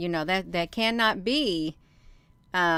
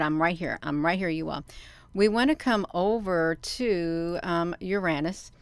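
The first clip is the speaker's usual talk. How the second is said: ordinary delivery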